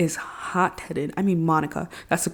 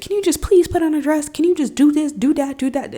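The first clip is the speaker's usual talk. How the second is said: imitating a nagging, annoyed mother; aggravated mom; 7 semitones higher than usual. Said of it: "in an angry and alter-, aggravated tone".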